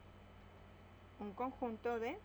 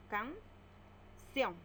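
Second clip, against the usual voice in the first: Canción drawn out slowly into can-ción; syllable by syllable; 2 semitones higher than usual. Shown as a demonstration. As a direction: split Canción into two slow beats. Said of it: "Separamos en sílabas". (Canción separada en sílabas, despacio)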